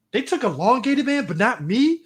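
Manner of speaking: mock wounded protest at being passed over; Booster Gold. Imitating a hero left out for Elongated Man, mock indignation. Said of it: "Booster Gold was like".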